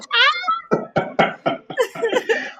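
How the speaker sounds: long high burst of laughter; big laugh. Real, not acted. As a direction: break into laughter. Laughs at own story.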